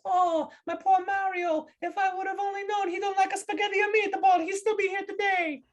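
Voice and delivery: very high, mock-grieving wail in Italian accent; wailing Italian widow. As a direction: lament your husband as a hammed-up Italian wife. Italian widow wails at funeral.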